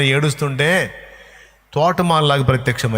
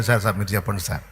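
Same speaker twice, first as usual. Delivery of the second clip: meek apology; 6 semitones lower, 6 dB quieter, faster than usual. Acted. Mother back to the minister.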